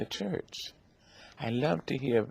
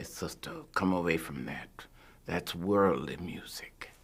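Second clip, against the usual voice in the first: lightly voiced grandmother, low and hushed; disapproving grandmother; 4 semitones lower, 5 dB quieter than usual. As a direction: quietly warn a child away, in a lower voice.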